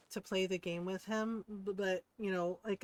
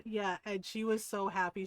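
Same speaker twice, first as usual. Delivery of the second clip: ordinary delivery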